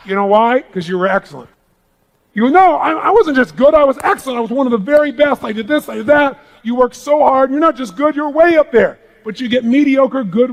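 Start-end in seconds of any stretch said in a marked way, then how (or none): 2.5-6.4 indignant protest. Retold or imitated. Achiever protesting their excellence.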